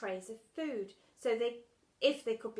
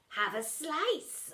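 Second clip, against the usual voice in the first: ordering; 6 semitones higher, 4 dB louder, much slower than usual. Acted. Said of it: "ordered Splat".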